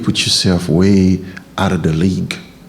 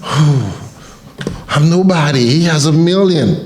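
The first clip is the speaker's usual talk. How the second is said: mock envious poster; 6 semitones higher than usual. Mocking envious follower-counter.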